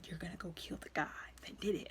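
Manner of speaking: fast, soft, chilling whisper; cold whisper. Retold or imitated. Father sending his son to kill, cold whisper. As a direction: hiss the command quietly.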